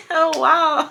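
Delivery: forced polite laugh then oh wow; fake laugh. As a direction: laugh it off without meaning it. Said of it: "my mom is fake laughing".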